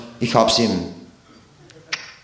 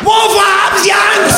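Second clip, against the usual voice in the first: screamed question; 10 semitones higher, slower than usual. Retold or imitated. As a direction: scream it in his face. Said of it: "hat er geschrien". (schrill geschrien)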